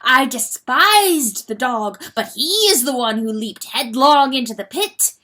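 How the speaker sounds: loud, high desperate confession; frenzied confession. Acted. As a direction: pour out the guilt in a frenzy. Rooster continuing frantic confession.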